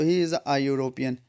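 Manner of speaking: slow answer. Shown as a demonstration. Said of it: "एन जो article use होते हैं".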